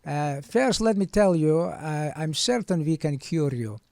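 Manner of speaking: hammed-up Russian doctor accent; Goldfinger impression. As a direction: play the doctor as a Bond villain. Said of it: "He sounds like Goldfinger".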